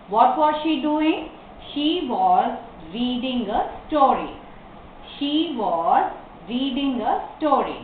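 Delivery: slow, phrase-by-phrase dictation; model reading. Shown as a demonstration. Teacher gives learners question and answer, slow reading. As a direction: pause midway through each answer.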